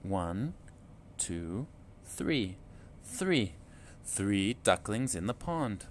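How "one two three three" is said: plain reading voice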